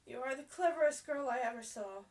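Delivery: loud, bright praise; delighted. A narrator's reading. Ermengarde praising Sarah, loud with delight. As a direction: gush with admiration. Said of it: "she cried out".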